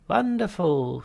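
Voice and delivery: sing-song greeting of a smug therapist; Halfrunt voice. Acted. Psychiatrist voiced.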